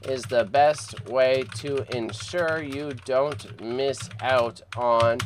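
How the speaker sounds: slow, deliberate read-aloud; slow careful reading. Real, not acted. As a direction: spell it out slowly for the viewers. Reading the auction notice slowly.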